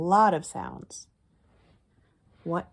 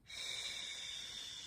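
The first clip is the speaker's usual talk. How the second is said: long soft unvoiced shh; a gentle hushing hiss; shushing sound; 17 dB quieter than usual. Shown as a demonstration. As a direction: hush it out like a quiet librarian. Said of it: "it makes that sound".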